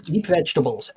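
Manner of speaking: usual speaking voice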